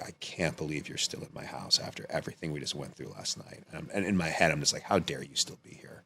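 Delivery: low, hushed retold outrage; quiet, inward indignation; contained disbelief. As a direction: say it under your breath, held in. Retelling silent outrage at mom.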